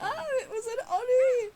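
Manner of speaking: high, loud burst of sudden recognition; excited realization. Real, not acted. Gamer suddenly realizes the spirit was an Oni, excited cry. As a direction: cry it out like you just solved the riddle.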